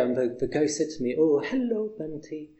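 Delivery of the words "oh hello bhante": put-on higher-pitched Indian English accent. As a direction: greet someone brightly in an Indian English accent. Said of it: "in this very particular kind of Indian English accent".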